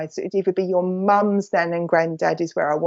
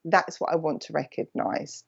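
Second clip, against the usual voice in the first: ordinary talk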